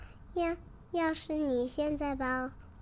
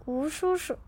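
usual speaking voice

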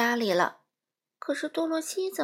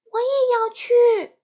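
put-on very high, loud child's plea; desperate shout; 12 semitones higher, 10 dB louder than usual. A narrator's reading. Dorothy shouts after Oz begging to go too. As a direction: cry out to be taken along. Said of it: "他大叫道".

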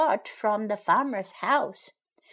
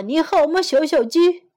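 higher, louder fox greeting; fox character; 5 semitones higher, 6 dB louder than usual. A narrator's reading. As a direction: call out to the little chick as the fox. Fox greets chick in translation.

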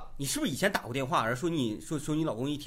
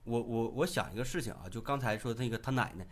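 ordinary talk

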